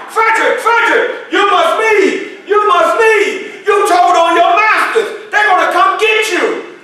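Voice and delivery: high, loud, slow frantic warning cries; frantic shouting. Acted. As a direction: yell it at him like the slave catchers are at the door. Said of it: "screaming and shouting".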